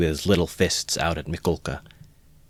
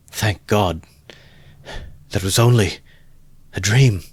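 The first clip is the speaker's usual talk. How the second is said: shaken relief; 3 semitones higher, 5 dB louder than usual. A narrator's reading. Waking from nightmare, relief.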